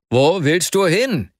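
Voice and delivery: loud, slow call across the street; shout. A narrator's reading. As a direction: call out to stop a running friend. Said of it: "schrie ihn Arkadi Iwanowitsch an". (lauter, langsamer Ruf)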